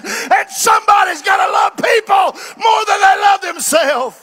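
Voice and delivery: loud, high preaching that climbs to a shout; shouting preacher climax. Acted. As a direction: build to a full revival roar. Sermon story builds to shouting.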